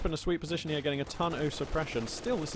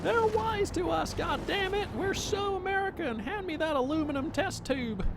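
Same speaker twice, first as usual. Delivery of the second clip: much higher, slow cartoonish American accent; mock American accent; slower than usual. Caster jokingly mimics American soldiers, hammed-up American accent. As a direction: ham up a goofy American accent. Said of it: "American accents".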